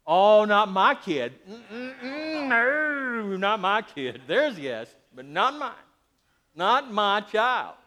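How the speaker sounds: a hammed-up doting parent insisting not my kid; mock denial. Preacher mocking parents who deny their child's mischief, hammed-up denial. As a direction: brush it off like a proud parent in denial.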